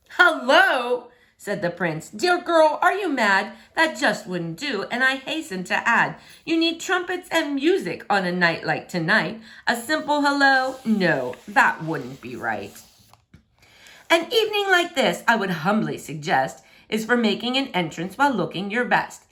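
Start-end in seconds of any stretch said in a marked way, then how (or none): 0.1-1.1 high, loud disbelieving hello; outraged at such a plain greeting; scandalised echo. Acted. Prince scoffs at simple hello. 2.1-19.2 lightly voiced haughty prince, a bit higher; pompous prince. Prince lectures Franny.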